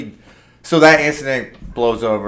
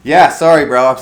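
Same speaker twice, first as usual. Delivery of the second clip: louder, higher sorry bro; loud sheepish apology; 4 semitones higher, 10 dB louder than usual. Retold or imitated. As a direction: brush it off embarrassed. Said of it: "And I was like".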